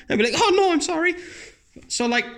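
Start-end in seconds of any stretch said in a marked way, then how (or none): 0.4-1.1 high, panicky apology; mock panic. Man to a woman coming on to him, mock panic. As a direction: squeak it out in comic panic. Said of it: "I'd fucking panic and start sweating".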